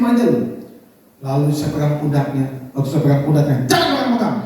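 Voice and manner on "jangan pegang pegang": sudden higher mock snap; retold sulky rebuff. Wife refuses her husband's touch, mock huffy and higher. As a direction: push him away with a quick cry. Istri ngambek menolak disentuh.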